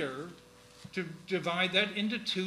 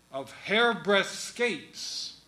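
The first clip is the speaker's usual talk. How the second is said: "Of hairbreadth scapes" very slow and louder, each of "hairbreadth scapes" hammered; heavy stress; 3 semitones higher, 6 dB louder, much slower than usual. Shown as a demonstration. Teacher drilling the three stressed syllables, slow and loud. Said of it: "have to have heavy stress there".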